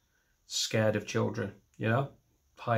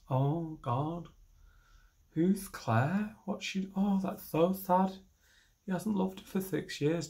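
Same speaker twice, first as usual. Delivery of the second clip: higher, softened voice of mock concern; pitying audience member; 5 semitones higher, 3 dB quieter, slower than usual. Audience member taking the joke to heart, pitying. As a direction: coo with hammed-up sympathy. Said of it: "when people don't laugh".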